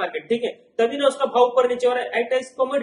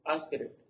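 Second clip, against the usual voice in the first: soft, much quieter and lower ask rate; term repeated for class; 7 semitones lower, 11 dB quieter than usual. Shown as a demonstration. Teacher answering his own quiz question, quiet and low. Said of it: "क्या बोलते हैं इसको?".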